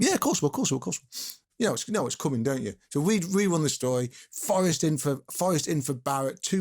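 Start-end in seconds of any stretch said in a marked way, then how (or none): 0.0-1.4 imitated impatient yes-yes, starting high and tailing away; breezy assurance. Manager waving away a reporter's condition, breezy assurance. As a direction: reel off retold reassurance, fading at the end.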